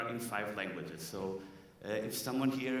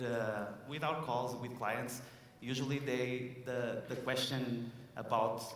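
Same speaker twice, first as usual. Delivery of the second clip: normal talking voice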